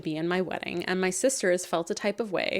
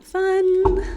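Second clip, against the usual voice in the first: excited; 12 semitones higher, 7 dB louder than usual. Pretend. Mock cheer about bachelorette plans.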